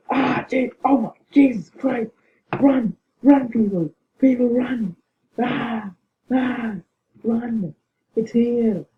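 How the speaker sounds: real panic, yelling warnings; panicked yelling. Real, not acted. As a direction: scream at everyone to run for their lives. Panicked gamer, monster attacking.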